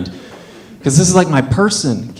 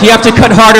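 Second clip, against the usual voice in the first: raised, higher voice, pressing order; urgent doctor; 8 semitones higher, 7 dB louder, faster than usual. Retold or imitated. Doctor urging a new dad at the cord, loud and urgent. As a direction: imitate an insistent doctor, loudly.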